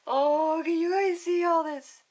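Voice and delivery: high, loud delighted squeal; excited squeal. Real, not acted. Reader squealing with excitement to the kids about the picture. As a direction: gush with delight over the picture.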